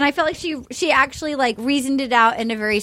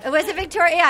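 ordinary delivery

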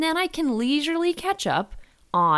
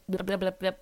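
quiet loosening babble; lip warm-up babble; 5 dB quieter than usual. Shown as a demonstration. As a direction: loosen the lips before reading. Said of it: "I will do my how now".

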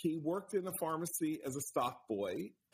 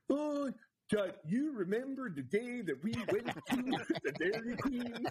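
put-on higher, sing-song Swedish-chef accent; bad Scandinavian accent; 8 semitones higher than usual. Movie love interest reminiscing on a stoop, bad Scandinavian accent. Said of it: "so there's that bad accent".

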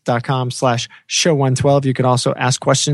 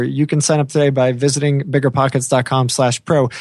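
ordinary talk

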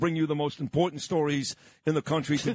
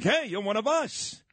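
mock royal welcome; 6 semitones higher than usual. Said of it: "they'd be like".

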